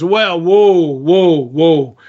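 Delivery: lightly acted guard stopping people; drawn-out whoas, stepping down in pitch; halting the crowd. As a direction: hold them back, one long whoa after another. Guard holds off the watching crowd.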